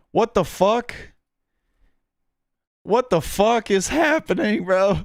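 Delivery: can't believe what he's reading; stunned disbelief. Real, not acted. Reacting to shocking headline.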